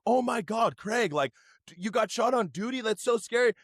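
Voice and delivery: high, gushing relatives; mock-concerned family at barbecue. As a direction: coo over him like worried aunts. Family gasps over Craig.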